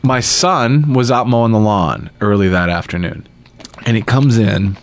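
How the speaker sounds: usual speaking voice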